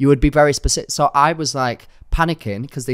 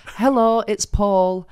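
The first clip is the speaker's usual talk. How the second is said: higher put-on scammer greeting; pretend caller voice; 9 semitones higher, much slower than usual. Scammer posing as solicitor's assistant, higher put-on voice. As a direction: imitate a caller introducing himself, pitched up. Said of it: "let's just pretend that they sounded like this".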